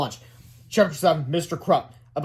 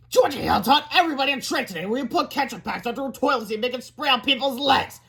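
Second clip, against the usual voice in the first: high, raised schoolboy voice; proud tattletale Melvin; 8 semitones higher than usual. A narrator's reading. School brainiac telling on classmates, proudly reporting. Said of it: "Melvin reported proudly".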